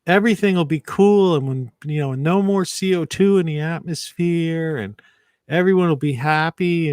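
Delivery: high, gushing parody of dreamy idealists; mocking idealist voice. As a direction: lampoon the utopian promises up high. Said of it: "it's like the A O Cs and these people".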